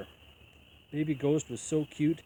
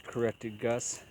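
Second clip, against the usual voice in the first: ordinary delivery, nothing marked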